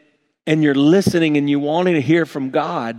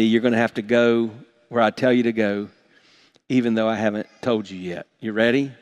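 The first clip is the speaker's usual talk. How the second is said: ordinary talk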